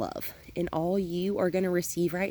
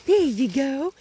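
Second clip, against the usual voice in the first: much higher and louder, slow praise; happy praise; 8 semitones higher, 5 dB louder, much slower than usual. Real, not acted. Trainer praising the puppy for chasing the toy. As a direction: cheer her on.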